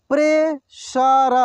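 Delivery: Pressure drawn out slowly, syllable by syllable; slow sounding out. Shown as a demonstration. Teacher slowly sounds out pressure for students. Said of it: "एक एक मात्रा को अलग अलग करके".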